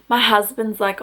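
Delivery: ordinary talk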